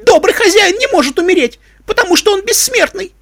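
very high, frantic shrieking; loud, hysterical mare's outburst; hysterical scream. A narrator's reading. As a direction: scream it like a fanatical believer. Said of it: "истерично закричала какая-то кобылка".

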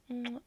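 loud smacking air kiss; kiss sound. Acted. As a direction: blow a kiss into the mic. Blowing kisses at camera.

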